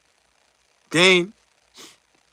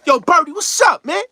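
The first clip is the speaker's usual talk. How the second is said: mock character greeting; 13 semitones higher, 6 dB louder than usual. Imitating a movie character's greeting.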